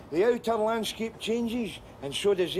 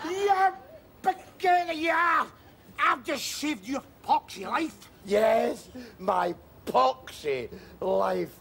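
angry exchange; 7 semitones higher than usual. Acted. Two men argue bitterly after the rescue. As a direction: throw the words at him in anger.

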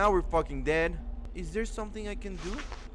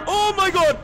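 scream; 14 semitones higher, 11 dB louder than usual. Real, not acted. Jump scare in horror game.

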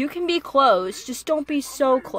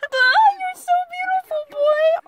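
much higher excited gushing; gushing squeal; 4 dB louder than usual. Real, not acted. Watching an anime, gushing over a skater in a squeal.

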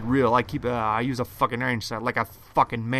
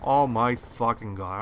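ordinary delivery

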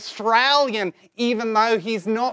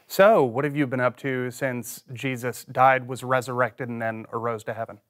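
low-voiced disciple; 13 semitones lower, 6 dB quieter, faster than usual. Plays a disciple in skit.

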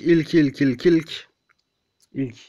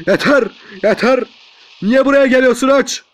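loud, higher protest; exasperated yelling; 8 semitones higher, 6 dB louder than usual. Real, not acted. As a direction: yell at the enemy to back off. Yelling at approaching game enemy.

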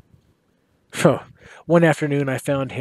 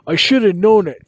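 higher, louder cry of despair; anguished cry; 6 semitones higher, 7 dB louder than usual. Acted. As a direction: cry it out, kicking himself. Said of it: "he cried".

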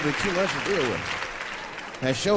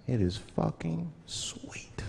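whispered, slow and soft; hushed, savoring whisper; 9 dB quieter, much slower than usual. Acted. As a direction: lean in and whisper the payoff. Punchline whispered.

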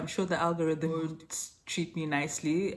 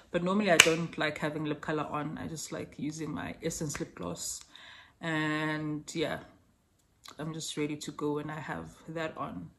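ordinary delivery

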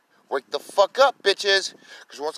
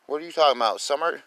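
ordinary delivery

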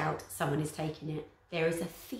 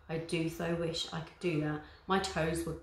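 usual speaking voice

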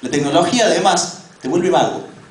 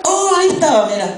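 mock delighted discovery; 12 semitones higher than usual. Searcher finding the dog's toy under the sofa, surprised discovery. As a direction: act out stumbling on a long-lost thing. Acting out finding a lost thing.

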